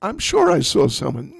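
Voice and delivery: put-on high, loud, stubborn old woman insisting; insistent old Jatila. Jatila insists to Lalita she saw someone, high and loud. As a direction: sure of what she saw, pressing the point. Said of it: "And Jatila said".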